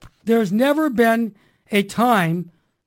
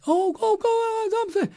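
high, slow delighted "go, go"; happy squeal; 13 semitones higher, slower than usual. Retold or imitated. Shop lady thrilled by the free app, squealing high. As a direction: imitate her thrilled high voice. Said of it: "She was all happy".

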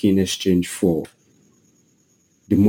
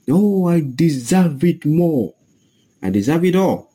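smug greedy man; 7 semitones higher than usual. Acted. Preacher voices greedy man.

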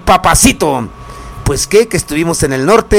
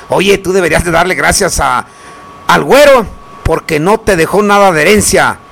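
ordinary delivery

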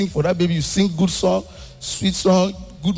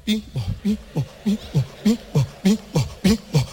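ping pong sounds. Shown as a demonstration. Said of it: "you will do it like this".

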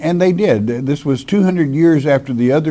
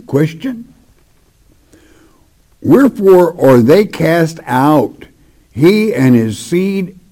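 measured, solemn Bible reading; sermon scripture voice; 3 semitones higher, 3 dB louder than usual. Acted. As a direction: read it like holy writ, a bit louder.

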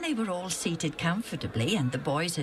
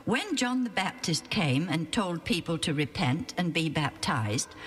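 ordinary delivery

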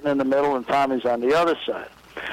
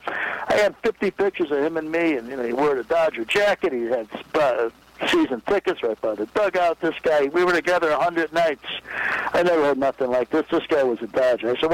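usual speaking voice

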